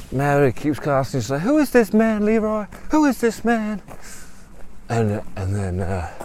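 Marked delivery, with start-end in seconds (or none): 1.4-3.8 high-pitched, insistent questioning; insistent demand. Acted. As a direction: demand an answer in a raised voice. Mary demands to know who he is.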